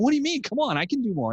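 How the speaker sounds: high, protesting retelling; protesting disbelief. Retold or imitated. Arguing with the CrossFit trainer, protesting. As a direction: pushes back at the trainer, pitched well up. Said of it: "And I'd be going".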